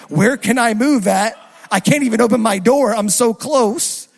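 loud, exasperated retort; exasperated protest. Retold or imitated. Driver stuck in drive-thru answers worker, loud exasperated protest. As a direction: protest loudly that you are stuck.

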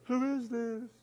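high, soft far-off echo. Acted. Canyon repeats the boy's question, distant echo. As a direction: echo it back high and faint. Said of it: "he heard".

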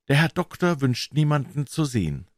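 a lightly voiced butler turning him away; servant voice. Servant refuses again.

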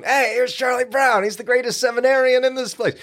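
high mocking singsong. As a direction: sneer it in a high voice. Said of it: "Again, Charlie Brown".

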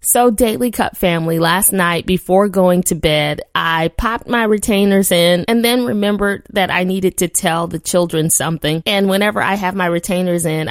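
ordinary talk, nothing marked

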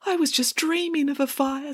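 put-on high, plaintive child's voice; wistful little girl. A narrator's reading. Girl wistfully excuses herself.

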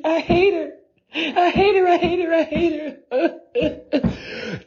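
high crying tantrum, slowing at the end; serious tantrum. Retold or imitated. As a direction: wail it like a kid breaking into tears. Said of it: "Like, on some serious".

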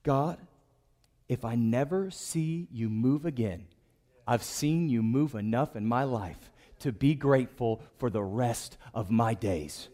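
low, soft, measured prayer; hushed, heartfelt thanks; grateful inner prayer. Acted. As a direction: pray quietly, as if thinking it to God. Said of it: "I think this is what he thought".

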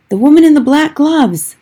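high, loud call down; frightened boy shouts down to grandmother; shouted. A narrator's reading. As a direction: shout the answer down from the tree.

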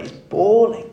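He's bawling, with bawling pushed high and loud; stress on bawling. Acted. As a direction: lean hard on bawling. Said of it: "It's not just".

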